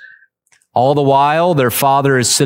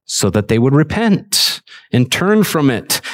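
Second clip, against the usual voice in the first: usual speaking voice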